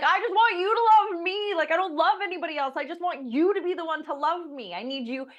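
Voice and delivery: high mock-needy plea to his wife; husband's plea. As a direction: whine it like a needy husband begging. Husband voiced pleading to wife.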